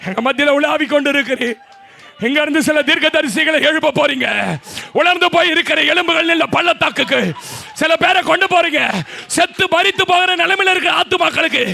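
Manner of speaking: shouted high prophetic preaching with tongues; preaching at full force. Acted. Pastor shouting prophecy over the congregation in full force. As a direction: cry out the prophecy over the church.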